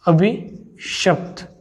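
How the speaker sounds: अभिशप्त drawn out slowly, every syllable clear; slow correct word. Shown as a demonstration. Teacher to exam students dictating अभिशप्त slowly. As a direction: stretch अभिशप्त out so the class can write it. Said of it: "अभिशप्त होता है".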